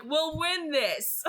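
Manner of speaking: big brother Emmett gloating from the back seat, laughing; Emmett's voice. Retold or imitated. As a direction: play Emmett cocky and amused, breaking into a laugh. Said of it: "and he's like".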